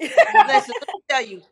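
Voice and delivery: laughing as she fends off the teasing; laughing protest. Real, not acted. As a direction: laugh it out, then settle.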